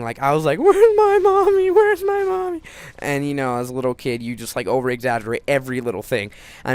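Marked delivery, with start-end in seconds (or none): 0.6-2.6 put-on loud, very high child's wail; imitated crying. Injured little kid wanting his mother, mock bawling. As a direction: bawl it slowly, hammed-up and helpless. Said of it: "I was just crying".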